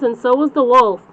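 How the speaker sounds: ordinary delivery, nothing marked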